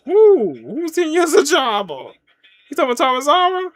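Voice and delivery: high, loud disbelieving challenge; incredulous outburst. Real, not acted. Streamer challenges a comment on Tozawa, incredulous outburst. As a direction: fire the question back, voice shooting up.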